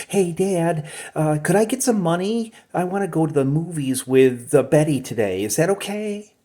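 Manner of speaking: put-on light, pleading teen voice; Archie's voice. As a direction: take the bass out and sound like a young person. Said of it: "that would be Archie".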